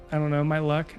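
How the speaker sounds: normal talking voice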